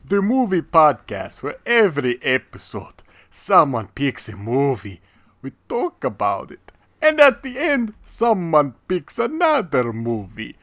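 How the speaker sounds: slow, theatrical vampire with a put-on accent; hammy Transylvanian vampire host; Dracula impression. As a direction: welcome them like a grand old vampire count, drawing out each phrase. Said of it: "I was doing Dracula".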